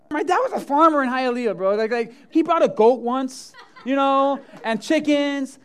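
loud and high, bursting with laughs; excited storytelling. Real, not acted. Pastor joking to the congregation about Hialeah, loud and excited. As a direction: let the excitement spill over.

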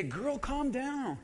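soft, slow calming phrase; soothing calm down. Shown as a demonstration. Congregant soothing a neighbor, soft and slow. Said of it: "turn to your neighbor and say".

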